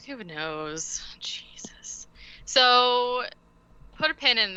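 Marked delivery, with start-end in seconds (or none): 0.1-2.1 a weary, voiceless aside; exasperated whisper. Real, not acted. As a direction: whisper it under your breath after a sigh.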